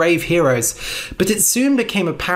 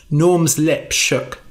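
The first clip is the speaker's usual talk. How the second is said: plain reading voice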